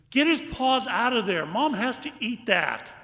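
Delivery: put-on high, loud scolding kid; voiced bossy child. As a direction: call out like a child taking charge. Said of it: "another voice says".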